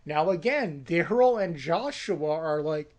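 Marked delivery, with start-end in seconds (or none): none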